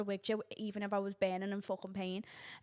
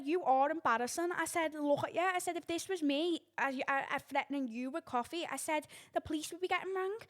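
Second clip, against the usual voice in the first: higher, indignant retold scolding; retold telling-off; 6 semitones higher than usual. To the old woman threatening her, a higher indignant scolding.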